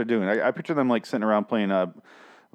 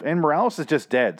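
normal talking voice

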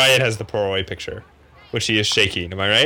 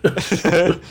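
laughing; 6 semitones higher than usual. Real, not acted. Laughing at partner's groan.

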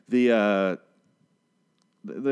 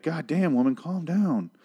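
exasperated; 6 semitones higher than usual. Retold or imitated. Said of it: "And you're like".

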